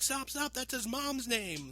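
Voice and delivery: loud, high mock-panicked cry; frantic Lois Lane. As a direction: burst in to stop a fight. Acts Lois Lane rushing in.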